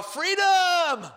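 high, loud, drawn-out victory cry; triumphant yell. Retold or imitated. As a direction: belt it out like an escaped prisoner. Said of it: "I wanted to yell".

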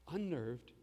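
normal talking voice

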